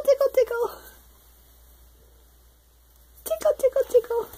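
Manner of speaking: very high squeaky tickle chant; giggly tickle attack. Real, not acted. Tickling the baby.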